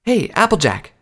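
high-pitched mare calling for attention. Acted. Recruit calls out to a platoon mate, high hail. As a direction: call over to a fellow recruit, pitched high. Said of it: "Slimblade called out".

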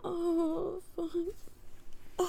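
usual speaking voice

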